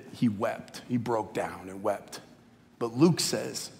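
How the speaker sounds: usual speaking voice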